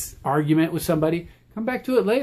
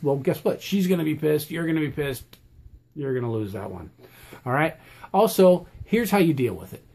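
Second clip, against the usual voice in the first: ordinary talk, nothing marked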